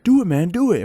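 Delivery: high mock taunt; goading dare. Classmate eggs him on to punch, high goading dare. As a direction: imitate a kid daring someone to swing.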